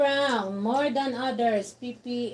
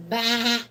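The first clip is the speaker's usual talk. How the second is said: loud short bah; sheep bleat; 6 dB louder than usual. Shown as a demonstration. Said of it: "What's the sheep say?".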